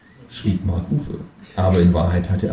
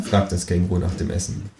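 ordinary talk

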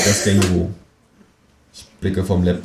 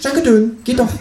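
high, chirpy kangaroo voice; cheerful; 5 dB louder than usual. A narrator's reading. Kangaroo pleased to get the chocolates, cheerful. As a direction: chirp it with loud, breezy triumph. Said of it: "sagt das Känguru fröhlich".

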